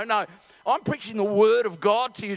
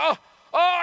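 raised mock cry of labour pain; acted pained oh; imitated labour groan; 7 semitones higher, 4 dB louder than usual. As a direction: imitate a woman gripped by a contraction. Wife in labour cries out.

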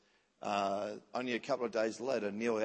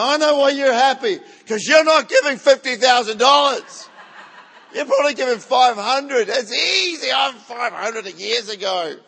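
high, loud mock outrage; exaggerated envious rant; hammed-up resentful shout; 10 semitones higher, 16 dB louder than usual. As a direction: yell at the happy givers in comic indignation. Acts out resentment of the happy givers.

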